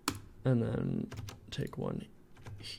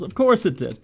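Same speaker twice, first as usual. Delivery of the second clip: higher, louder exasperated outburst; 8 semitones higher, 9 dB louder than usual. Real, not acted. Modeller finding weird triangles in the mesh, exasperated. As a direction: let real frustration burst out.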